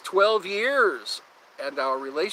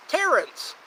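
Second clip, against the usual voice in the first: squeaky high cartoon call of Terrence; South Park voice; 11 semitones higher, 3 dB louder than usual. Recalling South Park to chat, high cartoon squeak. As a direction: squeal the name like a cartoon Canadian. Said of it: "That reminds me of South Park".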